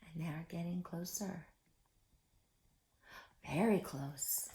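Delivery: soft, low hush; hushed suspense. A narrator's reading. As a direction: drop to a hush as they close in. Suspense whisper.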